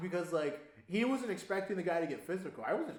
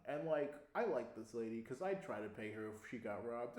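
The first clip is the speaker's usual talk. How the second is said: ordinary delivery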